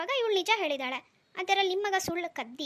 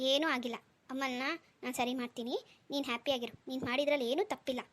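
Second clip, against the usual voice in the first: gentle comfort; 4 semitones lower than usual. Acted. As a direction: coax a sad child back to smiling. Father soothes upset daughter.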